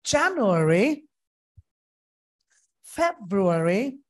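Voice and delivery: slow, careful English month names; model reading. Shown as a demonstration. Teacher reads all twelve months for students to copy. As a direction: read each month clearly as a model. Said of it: "এবার আমি আরেকবার পড়ে দিচ্ছি".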